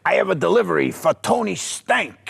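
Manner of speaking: imitating an old deliveryman calling out; Stan Lee. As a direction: call it out as a botched delivery line. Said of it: "he's like".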